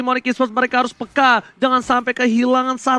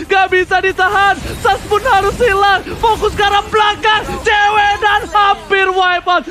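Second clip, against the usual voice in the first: hype shout; 4 semitones higher than usual. Real, not acted. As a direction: belt out the game-winning call. Game-winning climax call.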